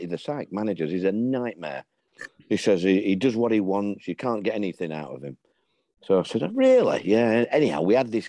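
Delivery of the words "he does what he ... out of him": ordinary talk, nothing marked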